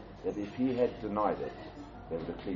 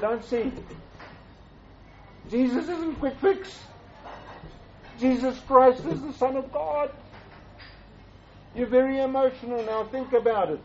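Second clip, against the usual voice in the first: pitched high and louder, pressing the point; earnest retold rebuke, raised high; urgent plea; 13 semitones higher, 4 dB louder than usual. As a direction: lift the voice high and drive it home. Preacher retells urgent rebuke to Hansi.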